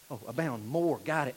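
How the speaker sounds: soft, low mock acknowledgement; offhand acceptance. Philippians answering Paul as if they get it, offhand. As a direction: toss it off as if understood.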